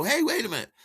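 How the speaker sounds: high-pitched dawning excitement; sudden bright idea. Retold or imitated. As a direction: act out hitting on an idea. Acts out his drunken idea.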